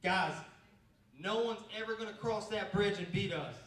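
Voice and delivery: rallying coach. Retold or imitated. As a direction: fire the team up from the mountaintop. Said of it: "he points at the bridge and he says".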